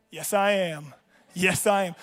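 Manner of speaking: low, cool cocky reply; smug self-assurance. Retold or imitated. Player told he is starting tonight, low and cocky. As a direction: drop it low, savoring it.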